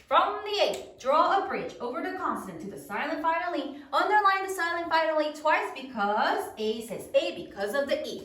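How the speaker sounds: rapid-fire classroom chant of a rule; fast recitation. Shown as a demonstration. Teacher leads third grade in reciting a rule, fast. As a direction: rattle off the rule without stopping. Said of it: "Let's do it fast".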